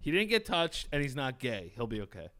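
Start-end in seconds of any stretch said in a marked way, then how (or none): none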